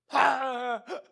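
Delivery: high-pitched imitated wail; mock sobbing. Daughter crying at her mother, mock wail. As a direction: burst into put-on crying. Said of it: "就哭了".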